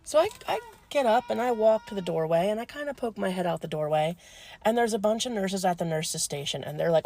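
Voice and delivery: normal talking voice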